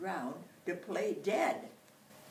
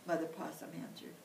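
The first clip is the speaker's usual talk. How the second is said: ordinary delivery, nothing marked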